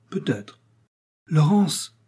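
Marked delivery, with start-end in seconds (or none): 1.3-2.0 calling her daughter, raised and high; a mother calling out Laurence in alarm. A narrator's reading. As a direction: shout Laurence from across the house. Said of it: "cria madame Richard".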